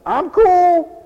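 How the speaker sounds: high, loud imitation, held on the last word; mock cool person. Preacher imitating what they say down here, high and loud. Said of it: "I hear that all the time".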